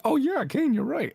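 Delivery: imagined agreement. Retold or imitated. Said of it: "I genuinely wanted to be like".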